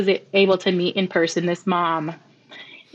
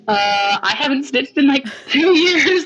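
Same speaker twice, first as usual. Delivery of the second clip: flustered disbelief; 6 semitones higher than usual. Retold or imitated. Retelling her own flustered reply.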